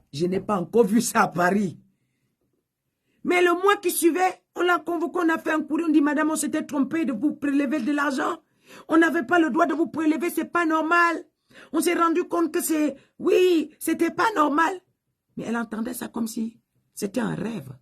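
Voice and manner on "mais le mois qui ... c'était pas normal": high, ringing preacher's climax; rising preaching fervor. Acted. Preacher proclaims the miracle to the congregation, high and fervent.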